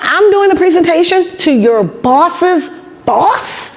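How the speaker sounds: high, slow, stunned question; can't believe it, ask it slowly and high; stunned employee echoing her boss; incredulous disbelief. Retold or imitated. Her own incredulous reply, acted.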